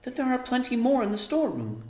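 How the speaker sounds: put-on high, quick girl voice; Arrietty explaining. A narrator's reading. Daughter explaining to mother, high quick voice. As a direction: hurry to explain. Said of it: "said Arrietty".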